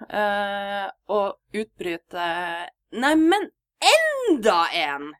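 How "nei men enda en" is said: mock yell, high then slow and drawn out on enda en; høyt utbrudd. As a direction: act out the flatmate's loud cry of astonishment. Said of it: "Veldig høyt". (høyt, forbauset utrop som drar ut «enda en»)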